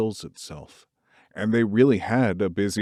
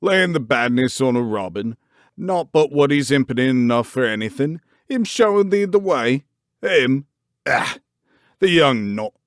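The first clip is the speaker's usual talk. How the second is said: loud, gruff, rising Yorkshire rant; indignant gardener mocking the girl's excuse; outraged old gardener; 4 semitones higher, 5 dB louder than usual. A narrator's reading. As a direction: spit out his scorn. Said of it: "He was so outraged".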